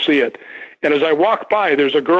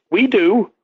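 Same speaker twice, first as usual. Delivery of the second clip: a hint of a woman's voice, lightly higher; 6 semitones higher than usual. Retelling the EVP, a lightly voiced woman's reply. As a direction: lightly voice a ghostly woman answering. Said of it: "a woman's voice".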